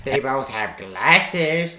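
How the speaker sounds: drawn-out, slow mock list item. Streamer mocking narrator's list of similarities, mocking. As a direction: parody a feeble argument, stretching the words.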